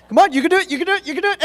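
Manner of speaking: high, eager coaching cheer; pep talk. Acted. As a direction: cheer the team on.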